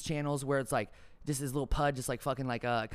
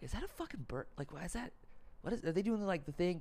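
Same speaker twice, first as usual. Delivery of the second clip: soft, higher, puzzled murmur; hushed confused crowd; 5 semitones higher, 7 dB quieter than usual. Retold or imitated. Stadium crowd staring up, hushed and higher. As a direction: mutter upward in disbelief.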